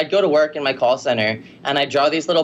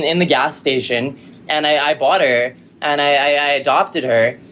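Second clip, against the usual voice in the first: usual speaking voice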